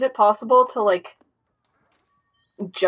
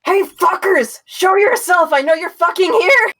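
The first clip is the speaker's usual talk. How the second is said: high, loud challenge to hidden foes; bold shout; 12 semitones higher, 6 dB louder than usual. Acted. Player calls out to whoever hides in the theater, bold shouting. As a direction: shout it out, pitched way up. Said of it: "I'm just gonna shout".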